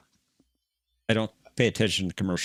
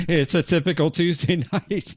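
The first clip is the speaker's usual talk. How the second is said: higher, louder, through real laughter; barely holding in the giggles; laughing; 8 semitones higher, 4 dB louder than usual. Real, not acted. Co-host amused by the derailed talk, laughing through the words.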